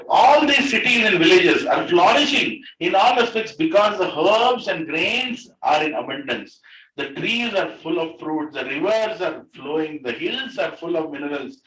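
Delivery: loud, measured recitation; teacher reading a prayer to the class, measured and devout; scripture reading. Acted. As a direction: recite the verse with devotion. Reads the prayer aloud.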